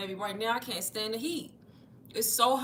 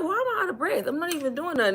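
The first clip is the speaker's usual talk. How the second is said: high-pitched mock bewilderment at oneself; baffled self-quote; 8 semitones higher, faster than usual. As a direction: replay your own puzzled complaint, pitched way up. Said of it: "I'm like".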